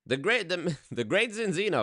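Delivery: lightly voiced grand stage magician; Italian magician announcer. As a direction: present yourself as a showy magician. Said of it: "an Italian magician".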